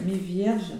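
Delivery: ordinary talk, nothing marked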